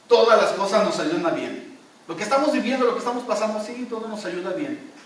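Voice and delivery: loud, high exhortation of todas las cosas; fervent preaching. Acted. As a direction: proclaim it boldly to the brethren.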